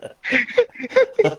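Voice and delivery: high burst of real laughter. Real, not acted. Recalling his friend's singed eyebrows, real laughter bursts out. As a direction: cracks up mid-story.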